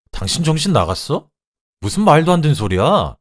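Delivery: high, loud outraged retort; indignant disbelief. Acted. Husband asks his accusing wife if she's crazy, indignant. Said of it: "저에게 미쳤냐고 물어보더군요".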